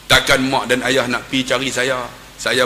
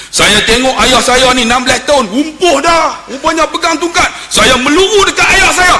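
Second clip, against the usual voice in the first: high, loud, overwrought cry; impassioned retelling; 10 semitones higher, 5 dB louder than usual. Retold or imitated. Son retells rushing to lame father.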